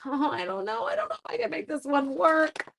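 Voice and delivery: high, fretful put-on worry; worried whine. Doubting herself over her partner's choice, high fretful whine. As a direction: whine it like you doubt yourself. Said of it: "I was like".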